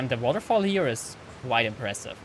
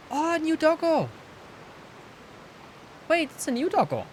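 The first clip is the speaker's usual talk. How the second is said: high, squealing delight; excited. Real, not acted. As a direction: gush like seeing a puppy. Thrilled by a new dog.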